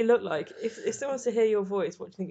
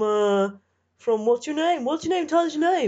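loud, high host pressing caller; cheesy radio presenter; 7 semitones higher, 7 dB louder than usual. Pretend. Host asks caller's name.

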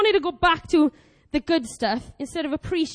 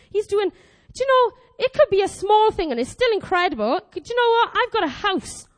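ordinary delivery

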